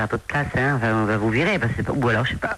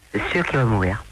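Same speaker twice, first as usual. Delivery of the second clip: ordinary talk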